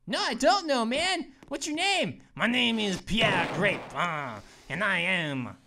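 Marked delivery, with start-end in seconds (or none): 2.3-5.6 put-on low, obnoxious French accent. French grape character introducing himself, hammed-up French accent. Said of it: "obnoxious French accents".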